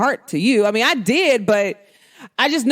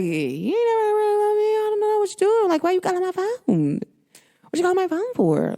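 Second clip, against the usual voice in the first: much higher whiny "You never really loved me"; mock whiny accuser; 9 semitones higher than usual. Mocking a clingy partner snooping her phone, whiny high. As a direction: ham up a needy accusing partner.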